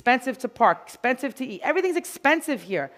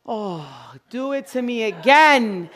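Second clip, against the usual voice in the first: blissful dog begging for more, slow, swelling loud at the end; talking dog; 5 dB louder, slower than usual. Acted. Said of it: "if you've never heard a dog talk, they speak, they go".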